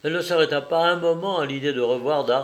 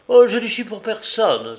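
high, loud, agitated exclamation; startled outcry refusing all visitors; crying out; 8 semitones higher, 7 dB louder than usual. A narrator's reading. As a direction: exclaim it urgently, pitch jumping up. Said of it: "s'écria-t-elle".

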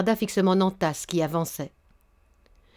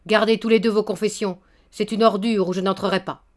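angry shout; 3 semitones higher, 3 dB louder than usual. Acted. Baron still raging at Nantas, louder. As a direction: keep shouting him down. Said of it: "cria d'une voix de tonnerre".

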